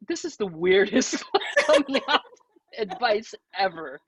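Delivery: amused by the parents' odd coming-out advice, laughing. Retold or imitated. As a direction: giggle out the weirdest advice.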